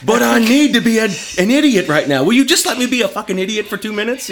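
high, loud, pleading whine; whiny retort. Retold or imitated. Husband whining at wife to let him vent. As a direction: beg to be an idiot. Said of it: "I'm just like".

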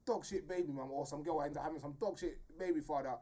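ordinary delivery

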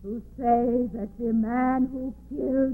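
slow, hushed, distant ghost; slow soft ghost voice; ghostly voice. Acted. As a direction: speak as a spirit from beyond. Dead wife's ghost speaking.

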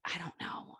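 unvoiced, hushed; whispered aside. Real, not acted. Doubting the new doctor, whispered to herself. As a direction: murmur it under your breath. Trailing off, unsure about doctor.

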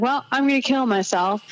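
high, upset declaration; upset quote. Retold or imitated. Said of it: "I'm like".

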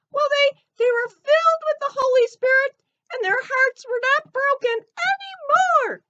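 high, squeaky cartoon elephant. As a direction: chirp it like a little elephant puppet. Ellie retells the story.

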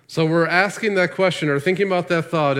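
normal talking voice

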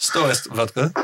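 low improvised Russian voice; deep Russian accent. Pretend. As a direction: answer in deep, thick Russian. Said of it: "That's a deep accent".

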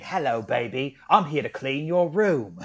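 put-on movie villain voice, drawn out at the end; Kano. Kano bursting through a wall in a Hoover ad, mock villain voice. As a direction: play a swaggering villain as a vacuum salesman. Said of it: "Now I'm picturing Kano".

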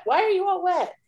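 high sing-song voice for the dog; high pet-talk voice. Real, not acted. Talking to the dog.

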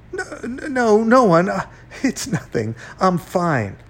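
high, stammering nervousness; flustered denial. A narrator's reading. Panicked man covering up the call.

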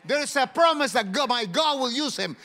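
loud, high rebuke of Satan; commanding the devil. Shown as a demonstration. Preacher showing the flock how to rebuke Satan, loud. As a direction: shout it at the devil with authority. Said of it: "you gotta say".

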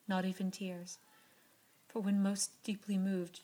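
ordinary delivery, nothing marked

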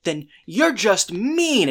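put-on high, loud pleading girl voice, slowing at the end; imitating Topanga. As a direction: plead with the judge, drawing out the last words.

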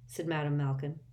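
plain reading voice

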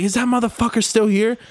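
lightly voiced woman demanding; a touch of her high voice; mock angry lady. Same quote retold again.

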